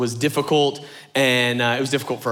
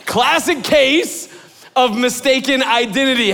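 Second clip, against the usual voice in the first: announcer punchline; 8 semitones higher, 3 dB louder than usual. Acted. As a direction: boom it like a game show title. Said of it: "announcer-style title".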